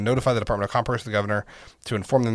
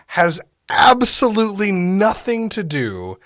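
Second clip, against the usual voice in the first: louder, higher-pitched emphatic rant; worked up; 4 semitones higher, 3 dB louder than usual. Real, not acted. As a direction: argue it heatedly, leaning on key words. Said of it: "I get so worked up about this".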